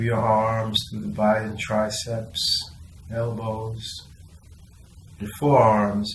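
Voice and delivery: soft, unhurried body-scan voice with long pauses; guided meditation. Acted.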